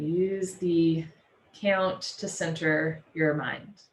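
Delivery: slow, evenly spaced counting with long pauses; measured yoga teacher leading breathwork; guided breathing. Real, not acted. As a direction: pace their breath, stretching each cue. Leads counted breathing exercise.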